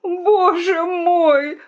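very slow, high wail; anguished moan. A narrator's reading. The rooster learns his egg is baking, moaning. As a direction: moan it out, stretched with dread. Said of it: "простонал петух".

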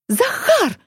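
high squealing cry for help; shrill shriek. A narrator's reading. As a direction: shriek his name. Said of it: "взвизгнула девушка".

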